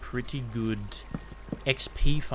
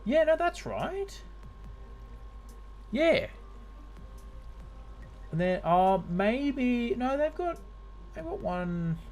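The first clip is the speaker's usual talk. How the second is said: high-pitched; 11 semitones higher than usual. Real, not acted. Checking Google, voice jumps up.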